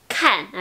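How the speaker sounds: "kan" with a puff after the K; aspirated K. Shown as a demonstration. Teacher announcing a demonstration, aspirated K in "kan". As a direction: release air sharply on "kan". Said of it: "Maintenant, je vais prononcer".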